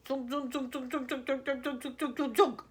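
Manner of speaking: quick, sharp barked syllables; barked bullets. A narrator's reading. Wonka barking nonsense at the aliens. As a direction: fire them off like bullets. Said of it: "so fast and sharp and loud".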